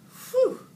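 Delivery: high loud puff of relief at the heat; overheated squeaky phew; hot phew. A narrator's reading. Hamster feeling the heat.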